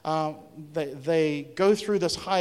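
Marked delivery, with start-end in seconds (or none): none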